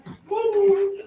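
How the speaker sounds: chirpy dog greeting in a high voice; voicing the happy dog. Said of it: "She's like".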